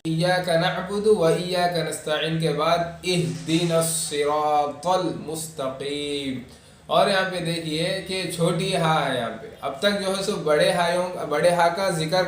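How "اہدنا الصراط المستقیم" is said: slow, stretched verse recitation; careful tajweed model; Quranic recitation. Shown as a demonstration. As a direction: recite measuredly with long vowels. Teacher models the next verse.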